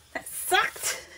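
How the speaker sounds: high, soft squeak of mock disappointment; squeaky repeat. Real, not acted. Crafter joking about her failed attempt, squeaky repeat. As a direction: squeak the words out as a joke. Repeats it playfully after failure.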